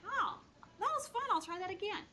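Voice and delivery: put-on high-pitched, cheerful child; little boy voice. Little Johnny to mom after a fall, high child voice. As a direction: pipe up like a reassured toddler.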